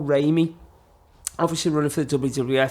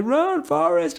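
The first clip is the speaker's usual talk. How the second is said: calling out; 12 semitones higher, much slower than usual. Retold or imitated. Shout across a field.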